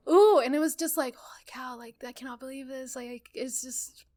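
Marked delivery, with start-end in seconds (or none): 1.1-4.0 much softer, whispered amazement; retelling her awed reaction, half-whispered. Retold or imitated. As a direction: hush it out in stunned awe. Retold awe at the sign.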